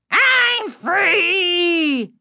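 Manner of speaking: high, drawn-out villain's crow; triumphant cry. A narrator's reading. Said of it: "the dummy cried".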